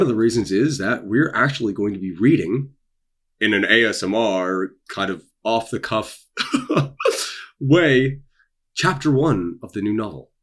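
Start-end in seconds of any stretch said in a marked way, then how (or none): 6.3-7.5 light laugh. Real, not acted. Laughs mid-sentence at own phrasing.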